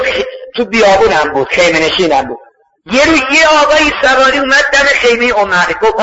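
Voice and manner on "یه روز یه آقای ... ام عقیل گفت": usual speaking voice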